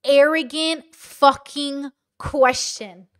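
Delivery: slow, loud, drawn-out disgust; outraged. Real, not acted. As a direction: spit out each word slowly in outrage. Outraged at arrogant question.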